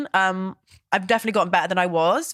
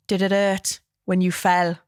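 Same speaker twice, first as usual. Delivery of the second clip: slow Welsh chat-up line; Southern Valleys Welsh; slower than usual. Pretend. Flirting at a pretend bar, slow Welsh accent. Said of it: "really Southern Valley Welsh accent".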